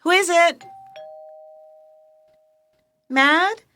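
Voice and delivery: loud call to another room. Real, not acted. Sick woman calls her nurse toward the door, loud. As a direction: shout it through the house.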